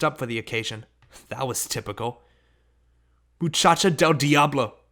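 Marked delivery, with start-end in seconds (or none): 3.4-4.8 Muchacha del Diablo! shrieked loud in fury; a mother's furious shriek. A narrator's reading. Mother shrieks at her runaway daughter, furious shriek. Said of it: "she shrieked".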